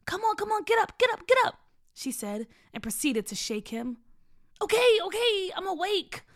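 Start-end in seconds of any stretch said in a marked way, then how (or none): 0.1-1.6 very high, loud, rapid-fire urging; urgent nagging. Acted. Sister shaking her brother awake, urgent nagging. 4.6-6.2 high, loud protest easing off; exasperated giving in. Acted. Brother being shaken awake by sister, exasperated giving in.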